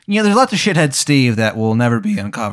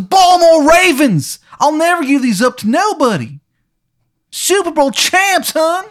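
put-on furious, Maryland accent; 5 dB louder than usual. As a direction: holler proudly in a bad Maryland accent. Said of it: "because he looks furious".

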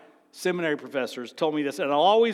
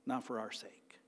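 whispered conviction; hushed whisper; 6 semitones lower, 17 dB quieter than usual. Real, not acted. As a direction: drop to a near whisper. Preacher drops to a hush.

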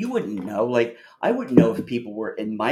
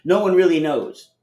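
higher, louder, fed up; exasperated outburst; 6 semitones higher, 7 dB louder than usual. Real, not acted. To Anil who went sideways, exasperated and louder. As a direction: cut the tangent off hard. Frustrated at off-topic answer.

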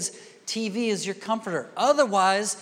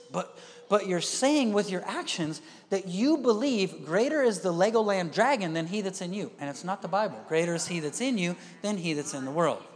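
normal talking voice

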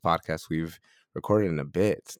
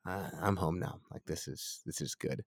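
soft relieved sigh; 7 dB quieter than usual. Retold or imitated. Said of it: "you're like".

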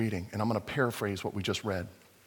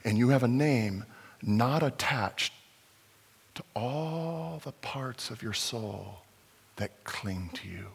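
tender appeal; 3 semitones lower, 5 dB quieter than usual. Real, not acted. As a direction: speak gently into their shame. Tender closing appeal to listeners.

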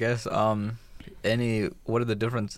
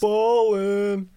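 high squeaky callout; 10 semitones higher, 6 dB louder than usual. Acted. Goofy outro callout.

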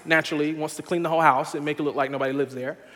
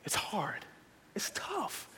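whisper; 5 semitones higher, 13 dB quieter, much slower than usual. Real, not acted. Preacher whispers hardship.

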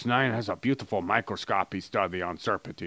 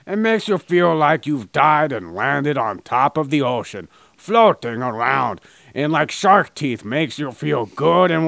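louder, overblown sales pitch; mock ad read; 3 semitones higher, 5 dB louder than usual. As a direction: hype up the product like a cheesy commercial. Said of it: "this is how my advertisements would go".

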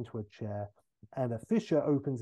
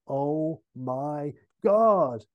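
loud, very slow drawn-out horror; stricken disbelief; 6 dB louder, much slower than usual. Acted. Scotty finding evils in his bed, stricken. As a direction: stretch each word in appalled shock. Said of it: "Scotty looks stricken".

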